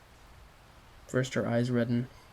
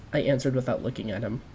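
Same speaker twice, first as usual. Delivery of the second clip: plain reading voice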